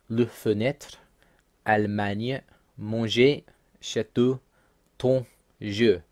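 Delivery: French word list, each word said separately; careful French vowels in fenêtre, manger, château, jeu; French pronunciation. Shown as a demonstration. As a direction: read Le fenêtre through jeu as French words. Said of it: "So some examples".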